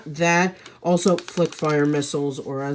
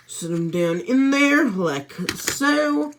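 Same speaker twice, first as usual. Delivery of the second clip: playful put-on Texan accent, pitched higher; Texan drawl; 8 semitones higher, slower than usual. Lego reviewer seats the police figure, playful Texan accent. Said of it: "I don't know why I'm talking all Texan".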